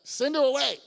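sharp high loud dismissal; annoyed disciples. Acted. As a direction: shoo her off impatiently. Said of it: "They're like".

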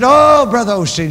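mildly hammed-up urgent appeal; mock excited plea. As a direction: call out as if alarmed. Retold excited church member.

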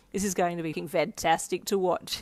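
ordinary delivery, nothing marked

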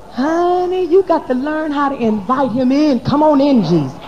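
loud, high preacher's call; fervent preacher's cry inviting Jesus in; preaching at full force. Acted.

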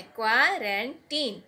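"क्वारंटीन" very slow with a long "ee"; teacher repeats "क्वारंटीन"; correct pronunciation. Shown as a demonstration. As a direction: slowly model "क्वारंटीन", "-teen". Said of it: "इस तरह से बोलना है".

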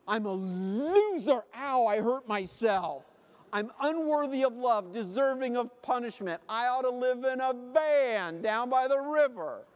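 wallow in over-the-top misery; hammed-up self-pity. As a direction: spiral into comic self-loathing. Spiralling pessimist played for a joke.

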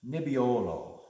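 NEBBIOLO with a long stressed O, falling away; Italian pronunciation. Shown as a demonstration. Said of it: "is how the Italians say it".

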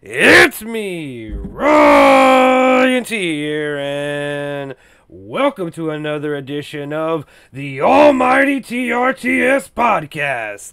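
big drawn-out announcer welcome; hyped podcast intro. Acted. Host greets the ghouls and gals, big announcer welcome. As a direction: announce the show like a hype host.

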